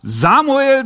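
a high, loud call of the name; ringing call from above. Acted. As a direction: call the name like God does. Preacher re-enacts God's call.

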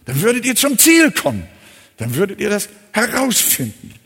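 a preacher hammering home faith over doubt; preaching at full force. Acted. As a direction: thunder the call to trust the signpost.

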